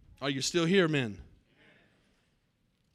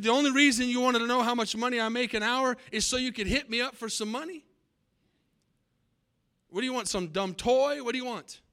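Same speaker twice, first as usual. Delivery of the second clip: angry father; 6 semitones higher than usual. Acted. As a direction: lash out like an irritated father. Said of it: "the father flew off the handle".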